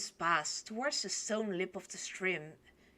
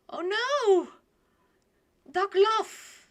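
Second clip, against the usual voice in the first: high, loud wail; comic dismay; 12 semitones higher, 8 dB louder, slower than usual. Real, not acted. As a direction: wail it in disbelief. Horrified reaction to duck romance.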